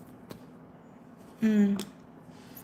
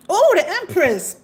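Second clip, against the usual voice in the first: normal talking voice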